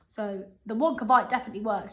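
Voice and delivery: ordinary delivery, nothing marked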